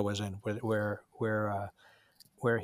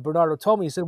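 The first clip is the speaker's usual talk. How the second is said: normal talking voice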